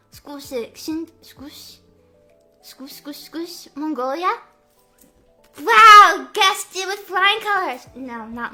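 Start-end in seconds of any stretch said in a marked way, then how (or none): none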